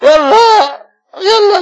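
ordinary talk, nothing marked

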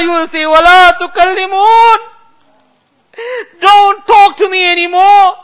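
ordinary delivery

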